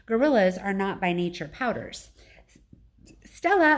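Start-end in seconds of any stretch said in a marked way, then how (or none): 3.2-3.8 calling out. A narrator's reading. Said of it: "I call".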